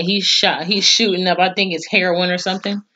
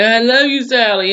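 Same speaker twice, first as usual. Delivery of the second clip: mock crying plea; 5 semitones higher, 3 dB louder than usual. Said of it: "he's crying 'cause the shit hurts".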